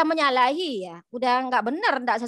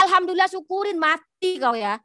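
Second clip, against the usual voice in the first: higher, spiteful gloat at a sick enemy; mock gloating curse; 6 semitones higher than usual. Imagined Prophet gloating over the sick spitter, mock spiteful curse. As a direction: crow over his illness, hammed up. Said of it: "Ada Rasulullah bilang gitu?".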